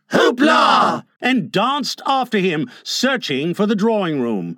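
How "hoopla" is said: loud, high group cheer; chorus shout. A narrator's reading. Boys dancing after Mr Darling, chorus shout. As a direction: shout it together, high and gleeful. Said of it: "and they all cried".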